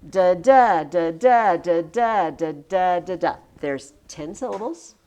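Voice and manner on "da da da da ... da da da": chanted da-DA; counting the meter; iambic beat. Shown as a demonstration. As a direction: tap the ten beats. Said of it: "scan it".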